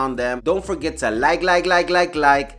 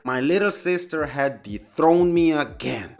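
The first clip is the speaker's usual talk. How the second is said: ordinary delivery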